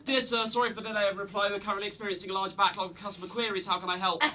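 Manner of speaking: rapid run-on reading, no pauses; no punctuation. Acted. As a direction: rattle it off as one breathless sentence. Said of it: "No punctuation".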